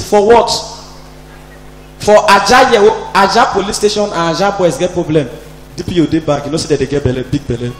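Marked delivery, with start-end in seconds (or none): none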